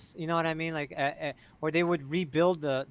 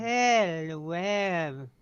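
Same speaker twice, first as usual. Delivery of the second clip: crazy Care Bear voice; much slower than usual. Retold or imitated. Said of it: "like a crazy Care Bear".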